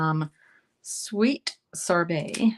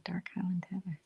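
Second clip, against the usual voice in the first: whisper; 11 dB quieter than usual. Real, not acted. Savoring the color name again.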